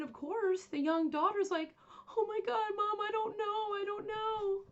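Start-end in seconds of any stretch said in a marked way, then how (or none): 2.2-4.6 high, panicked and near tears; hysterical daughter. Retold or imitated. Young daughter answers her screaming mother, panicked and tearful. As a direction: plead in a frightened, high-pitched voice. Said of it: "The kids are in hysterics".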